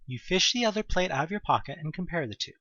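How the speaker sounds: ordinary talk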